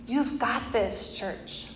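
slow, hushed "You've got this, church"; soft encouragement. Pretend. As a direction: gently assure the whole church. Slow closing encouragement to congregation.

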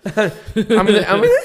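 squeaky cracking voice. Real, not acted. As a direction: voice breaks up high. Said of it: "sound like a broken squeaky toy".

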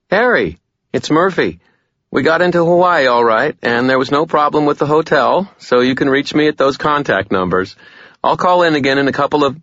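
brisk woman leaving a message; Murphy. A narrator's reading. Murphy's voicemail.

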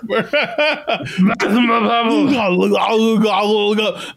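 mock gargled gibberish yell; long babble of hammed-up Austrian vowels; Arnold Schwarzenegger. Hack comic imitating Arnold, nonsense garbled yelling. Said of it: "talk to hack comedians".

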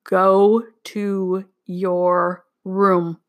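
very slow, word-by-word command; stern order. A narrator's reading. Father banishes daughter to her room, sternly. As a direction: space out every word with controlled anger.

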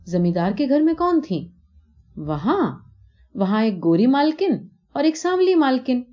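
put-on a small girl explaining what she saw; little girl.

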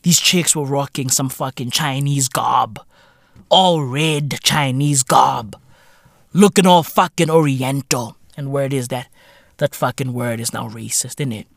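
ordinary talk

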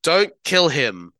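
Star-Lord calls after Nebula, slow loud call. Retold or imitated. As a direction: call it after her slowly. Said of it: "calls out".

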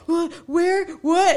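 a hint of a befuddled man; confused drunk man. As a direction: play him bewildered, short questions.

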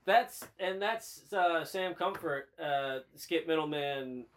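slow, drawn-out newsman sign-off; slow anchor outro. Pretend. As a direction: stretch each word like a tired anchor.

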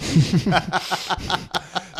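a loud high burst of laughter; big real laugh. Real, not acted. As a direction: crack up loudly at the joke. Laughing at the joke.

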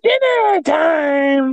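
high, very slow drawn-out gloat; hammy villain. Retold or imitated. Friend quoting Yzma, slow and high gloating. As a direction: stretch each word like a cartoon villain.

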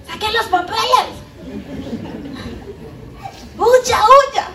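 mock panic. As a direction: cry out like a panicked crowd fleeing. Mocking panicked voice. (pánico burlón, mucho más agudo)

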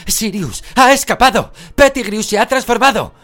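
high, full-force yell; urgent, very loud cry for help; alarmed shout. A narrator's reading. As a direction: raise the alarm at the top of your voice. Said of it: "gritó Harry".

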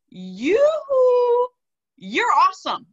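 high, loud, sung-out wake-up call; calling out yoo-hoo. Acted. Guest to people doubting themselves, calling out yoo-hoo. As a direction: holler it brightly to get their attention.

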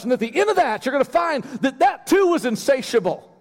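high-pitched, driving sermon delivery; impassioned preaching. Real, not acted. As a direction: preach it with rising urgency. Preaches at full force.

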